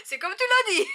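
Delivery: high loud burst of laughter; laughing. Real, not acted. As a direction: laugh it out helplessly.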